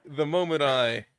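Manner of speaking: British accent attempt. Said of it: "go back into British".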